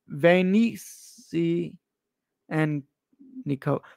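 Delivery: "Venici and Nico" in slow, separated chunks; sounding out syllables. Real, not acted. Streamer working out the brand name for viewers, "Venici and Nico" piece by piece. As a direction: break it up as "Venici ... and ... Nico", drawn out. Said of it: "Thank you for breaking it up".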